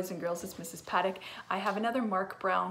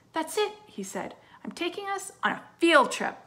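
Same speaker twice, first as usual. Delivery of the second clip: high, loud burst of inspiration; a dad struck by a bright idea; sudden idea; 10 semitones higher, 8 dB louder than usual. Acted. Father announcing a field trip, high and excited.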